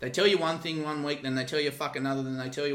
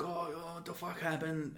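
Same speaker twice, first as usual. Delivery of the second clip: soft, slightly higher mock confusion; lightly mimicked dazed man; 4 semitones higher, 9 dB quieter than usual. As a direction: lightly imitate someone groggily coming round. Voicing dazed man coming round.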